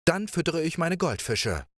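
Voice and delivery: playful princess. Acted. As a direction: coax him like a carefree young princess. Princess answers.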